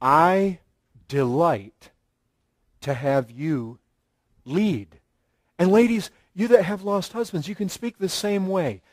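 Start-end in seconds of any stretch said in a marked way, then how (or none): 0.0-5.0 softly, sweetly lowering toward the end; gently pleased to be led; a hint of a tender wife; sweet delight. Shown as a demonstration. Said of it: "this is the way submission speaks".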